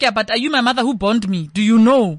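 loud, mock-confrontational comeback; indignant retort. Comedian retorting to people who question their accent, indignant.

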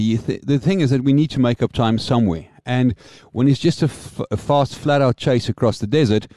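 usual speaking voice

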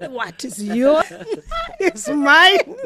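giggling through the punchline; teasing, laughing high. Real, not acted. Teasing claim on husband's salary.

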